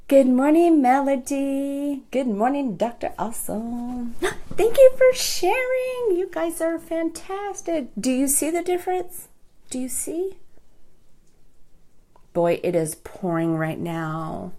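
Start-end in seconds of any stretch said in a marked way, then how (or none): 0.1-10.4 high, gasping excited hello; excited greeting. Real, not acted. Host gasping with joy greeting live viewers by name.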